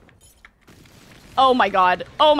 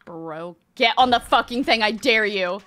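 ordinary talk, nothing marked

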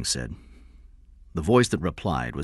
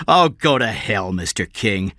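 loud angry dismissal; 3 semitones higher, 6 dB louder than usual. Acted. Detective cursing the man who refused to pay, angry. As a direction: lash out, voice raised. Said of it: "Corella said angrily".

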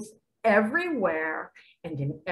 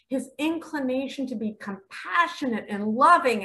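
ordinary delivery

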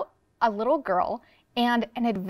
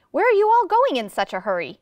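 higher, louder storybook question; calling out; 6 semitones higher, 5 dB louder than usual. A narrator's reading. Cyclist asks the hurrying bee chasers, calling out. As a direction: call it over to people passing.

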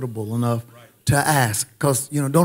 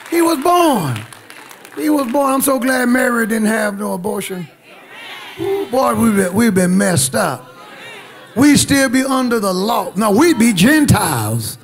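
high-pitched pulpit cry easing as it goes; exultant preaching; 6 semitones higher, 3 dB louder than usual. Real, not acted. Preacher glad Christ was born, exultant preaching. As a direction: call it out high, riding each phrase.

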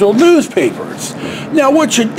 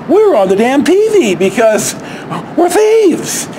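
indignant outburst; 6 semitones higher than usual. Real, not acted. Telling viewers the news called them thieves, outraged high exclaim. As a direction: pitch jumps way up on thieves. Shocked to be on TV.

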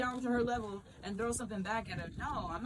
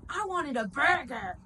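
loud, higher, drawn-out demand; imitated homeless woman; 4 semitones higher, 6 dB louder, slower than usual. Homeless lady rejecting the free food, imitated loud complaint. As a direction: mimic an indignant complaint, slow and raised. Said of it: "Literally, she said that".

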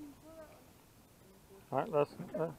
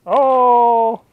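long, high, loud "oh"; excited shout; 7 semitones higher, 9 dB louder, slower than usual. Real, not acted. Angler reacting to a fish on the line, excited shout. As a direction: holler out in surprise on the water.

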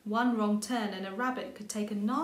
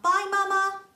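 high, loud goodbye call; 10 semitones higher, 9 dB louder than usual. A narrator's reading. Little rabbit calling goodbye to her mother as she bounces off, loud and high. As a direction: call it out brightly, higher and louder. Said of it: "calling out".

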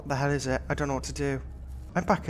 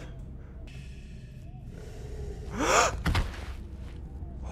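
high scream; 4 dB quieter than usual. Real, not acted. Monster attack.

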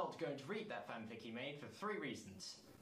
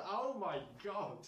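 loud, higher, slowed oh my god; horrified exclamation while reading; shocked outburst; 5 semitones higher, 5 dB louder, slower than usual. Real, not acted. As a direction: blurt it in disbelief.

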